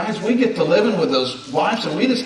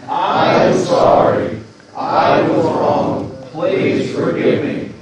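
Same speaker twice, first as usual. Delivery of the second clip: louder, slower, every word pushed out; more enthusiasm; 4 dB louder, much slower than usual. Shown as a demonstration. Pastor urging the congregation to repeat it with more enthusiasm, loud and slow. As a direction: say it like you really mean it, with gusto. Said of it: "with a little more enthusiasm".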